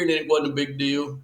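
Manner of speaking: ordinary delivery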